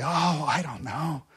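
modest, drawn-out "oh" of reluctance; humble demurral. Acted. Humble person answering an admirer, modest reluctance. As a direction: play a humble person shying from praise. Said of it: "are super humble and say".

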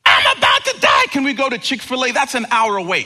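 put-on fast, loud whining child; whiny kid. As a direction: rattle it off like a dramatic kid. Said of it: "kids who use this phrase".

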